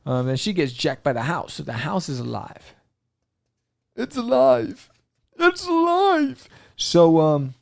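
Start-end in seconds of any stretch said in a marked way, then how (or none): 4.0-4.9 high, loud "It's alive"; mad scientist cry. Pretend. Frankenstein quote, house alive. 5.4-6.5 "It's alive" sung out very high, loud and slow; mad scientist shriek. Pretend. Repeated Frankenstein quote, higher.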